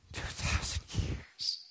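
slow, voiceless whisper of disbelief; stunned whisper. Acted. New converts to missionaries on hearing the delay, stunned whisper. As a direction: whisper it, as if the news has floored you.

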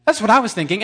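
usual speaking voice

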